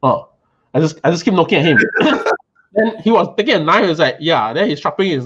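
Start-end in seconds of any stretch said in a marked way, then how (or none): 1.8-2.4 quick high airy laugh; high breathless giggle. Real, not acted. A giggle while telling friends about the chef.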